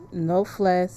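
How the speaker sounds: ordinary talk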